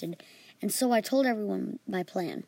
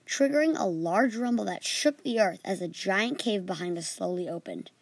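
plain reading voice

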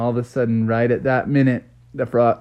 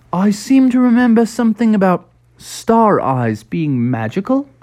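lightly voiced dad; 7 semitones higher than usual. Froggy's dad voiced in story.

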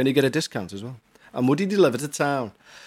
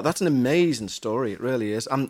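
normal talking voice

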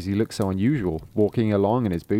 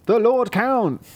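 high voices; 12 semitones higher, 4 dB louder, slower than usual. Acted. Workers answer the king.

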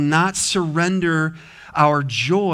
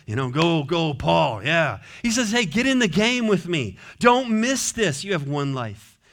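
raised, ringing pulpit voice; full-force preaching; 5 semitones higher than usual. Acted. Pastor urging the congregation to rejoice, preaching hard. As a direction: preach it out with rising fire.

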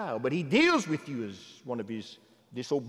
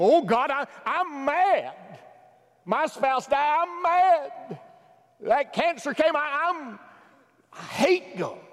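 much higher, loud cry of anger at God; mock angry scream; 12 semitones higher, 5 dB louder, slower than usual. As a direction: cry out like someone furious at God. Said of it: "And some people scream".

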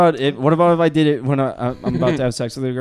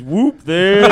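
a loud, higher whoop at a passing woman; crude old guy catcall; 6 semitones higher, 7 dB louder than usual. Retold or imitated. Retelling his cousin's catcall, loud and leering. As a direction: hoot it out like a leering old man.

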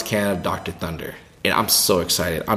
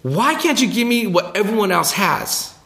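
put-on high, indignant kid wail; furious kid pleading with Jesus; jealous Simon; 11 semitones higher than usual. Simon demands from Jesus what others got, high. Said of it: "furious now".

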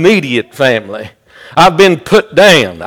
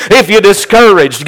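rhythmic, driving sermon delivery; fervent preaching; 4 semitones higher than usual. Real, not acted. Preacher urging the congregation that he knows, fervent preaching. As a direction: preach it with insistent repeated cadence.

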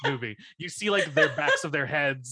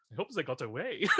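soft, hushed aside with a laugh; quiet aside; 8 dB quieter, faster than usual. Retold or imitated. Friend acting out the bow aside to Max, softer quiet line. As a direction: murmur it to Max as a secret hope. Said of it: "and you go".

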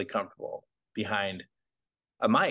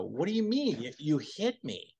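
mock indignant; 6 semitones higher than usual. [name] protests to Norm that he was hit, mock indignant. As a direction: act wounded and accusing.

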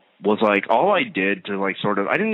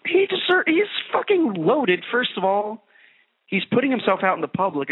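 much higher, slowed, indignant; outraged high rant; 12 semitones higher than usual. Real, not acted. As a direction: blurt it out in disbelief. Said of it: "indignant rant".

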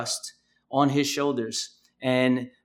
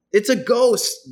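higher and louder, a startled cry; mock terror, pitched up and loud; frightened cry; 7 semitones higher, 5 dB louder, slower than usual. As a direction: blurt "It's a ghost" like the disciples seeing something in the storm. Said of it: "they cried out with fear".

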